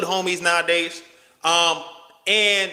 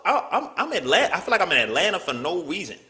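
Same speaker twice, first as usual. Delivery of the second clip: ordinary talk, nothing marked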